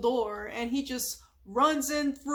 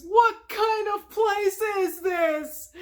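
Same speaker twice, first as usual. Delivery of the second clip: high, loud, slow yell; panicked scream; 9 semitones higher, 6 dB louder, slower than usual. Retold or imitated. Patrick discovering there's no water, screaming. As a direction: scream it in horror. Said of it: "he screams".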